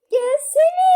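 loud shout. Real, not acted. Calling brother in the game.

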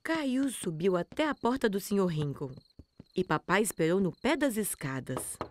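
plain reading voice

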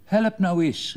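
high, loud strained call. Acted. Father tugging at a leg calls out, high and loud. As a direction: yell for help while tugging hard. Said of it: "riep hij".

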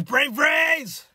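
pained shout, high and loud; brain freeze. Real, not acted. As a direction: burst out yelling from the ache.

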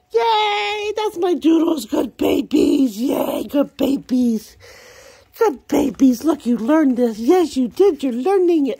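high, loud, slow doggy praise. Real, not acted. Owner praising dogs that learned, loud high praise. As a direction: cheer the pups on.

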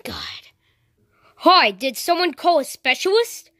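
ordinary talk

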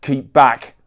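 loud gruff warning bark; growled. Acted. Bodyguard warning off the paparazzi, loud growl. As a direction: push the photographers back with your voice. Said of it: "Big T growled".

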